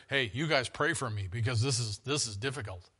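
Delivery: low, soft plea for prayer; quiet humble plea. Retold or imitated. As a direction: drop low and ask for prayer. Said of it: "I'm paraphrasing here".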